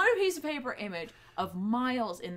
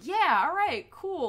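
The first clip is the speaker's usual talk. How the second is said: put-on breezy teen; 8 semitones higher, 6 dB louder, slower than usual. Miles voiced in retold scene.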